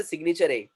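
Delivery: "signature" with an added "ay", "signatu-ray"; mispronounced "signature" ending in "ay"; wrong final E. Shown as a demonstration. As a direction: voice the silent E in "signature". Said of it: "We don't say".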